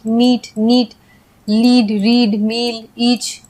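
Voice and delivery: मीट, नीट, लीड, रीड, मील, ईच each with a long ee, slow; drawn-out ee drill, word by word; long ee demo. Shown as a demonstration. Teacher reading the ea word list aloud, slow long ee. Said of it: "ऐसे पढ़ेंगे".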